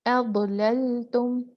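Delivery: अबललतुम drawn out slowly, letter by letter; Arabic letter demo. Shown as a demonstration. Teacher models अबललतुम slowly for students. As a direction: sound out अबललतुम with the teacher's articulation.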